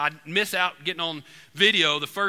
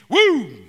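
very high, loud whoop for the shot; 11 semitones higher, 11 dB louder than usual. Retold or imitated. Preacher imitating the second shotgun blast, high loud whoop. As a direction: let out a big high yelp like the gun going off.

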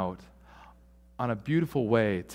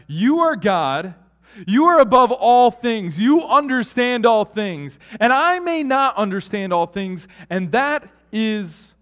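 much louder, higher proclaiming prayer; fervent prayer; 9 semitones higher, 9 dB louder than usual. Acted. A humble believer proclaims to God, loud and fervent. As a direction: declare it up to God with force.

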